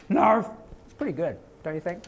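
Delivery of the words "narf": a quick loud cartoon "Narf"; Pinky's Narf. Answering fans with Pinky's goofy catchphrase. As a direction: bark out "Narf" like the goofy lab mouse. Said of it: "that's what Pinky Swabo would say".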